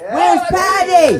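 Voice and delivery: loud, high, drawn-out crowd call; rally the room, yelling slowly; hype shout. Real, not acted. Comic calling to the audience for Patty, shouting. Hyping crowd about Adderall.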